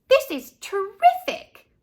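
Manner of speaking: put-on high, loud thrilled girl voice. A narrator's reading. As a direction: gush with delight. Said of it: "said Leslie".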